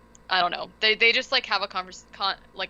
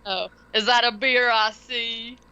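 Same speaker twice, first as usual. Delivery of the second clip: mock Southern accent; 3 semitones higher, 4 dB louder than usual. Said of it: "you gotta do the accent".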